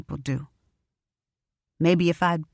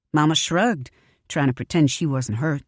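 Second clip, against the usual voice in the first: ordinary delivery, nothing marked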